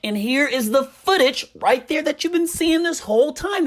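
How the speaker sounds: usual speaking voice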